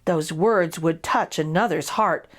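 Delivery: ordinary delivery, nothing marked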